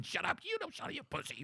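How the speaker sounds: high then dropping soft, mock taunt; hammed-up inner tough guy; juiced-up younger self. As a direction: sneer it like a cocky gym rat.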